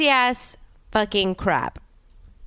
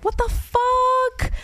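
drawn-out, much higher cry of disbelief; shocked disbelief; much slower than usual. Retold or imitated. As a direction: stretch it out high, stunned. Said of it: "I'm like".

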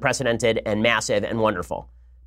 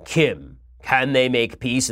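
big narrator voice; much slower than usual. Retold or imitated. Host mimicking the summit tape's narrator, big narrator voice. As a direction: intone it slowly and weightily, like a movie narrator. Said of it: "with a big narrator going".

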